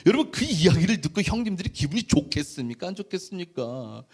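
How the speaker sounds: ordinary talk, nothing marked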